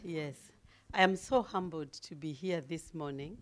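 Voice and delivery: normal talking voice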